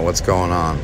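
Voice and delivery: usual speaking voice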